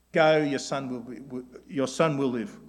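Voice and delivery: a hint of curt dismissal; dismissive. Shown as a demonstration. Preacher showing the dismissive tone Jesus did not use. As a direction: brush him off lightly. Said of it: "it's not that tone of dismissiveness".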